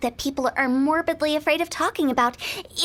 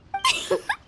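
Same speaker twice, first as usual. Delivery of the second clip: high, quick giggle; a delighted little laugh at her own slogan; gleeful giggle. Real, not acted. Director pleased with her sales slogan, giggling.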